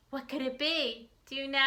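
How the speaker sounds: plain reading voice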